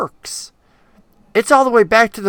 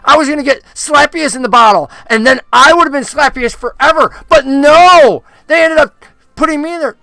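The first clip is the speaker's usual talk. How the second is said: ranting puppet; 5 semitones higher, 6 dB louder than usual. Acted. Puppet explains the bottle angrily.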